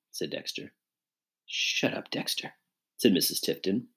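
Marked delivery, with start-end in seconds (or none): 1.5-2.5 very soft, unvoiced shut-up; whispered annoyance; hushed rebuke. A narrator's reading. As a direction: silence him under your breath. Woman snaps at companion under breath.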